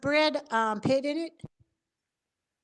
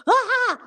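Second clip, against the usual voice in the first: high-pitched "ah!" breaking into laughter; mock startled cry; 13 semitones higher, 3 dB louder than usual. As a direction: imitate a startled cry, amused. Retold startled cry, teller laughing.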